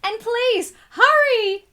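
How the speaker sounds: high, loud urgent please hurry. Acted. Boy urging the fireman to hurry, high urgent plea. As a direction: beg them to rush.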